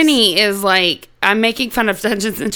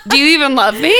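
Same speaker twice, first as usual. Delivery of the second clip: higher, laughing accusation; mock hurt demand; 5 semitones higher, 4 dB louder, much faster than usual. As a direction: play the wronged friend, half laughing. Mock quarrel over missing shirt.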